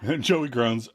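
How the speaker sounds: ordinary talk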